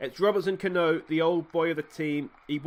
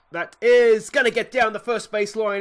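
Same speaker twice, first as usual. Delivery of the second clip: higher and louder, a sudden burst of excitement; excited call; 7 semitones higher, 9 dB louder than usual. Real, not acted. Gamer calling his own batter's hit, excited. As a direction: call the hit down the line like a thrilled fan.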